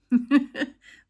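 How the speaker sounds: quick high chuckle; short laugh. Real, not acted. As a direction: laugh at your own joke. Laughs at own joke.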